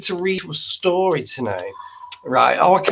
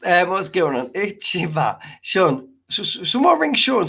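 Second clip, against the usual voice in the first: ordinary delivery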